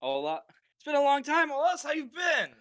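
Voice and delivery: loud and high friendly call; hearty greeting. Acted. Anton greets the blacksmith, loud and hearty. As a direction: greet an old friend warmly across the shop.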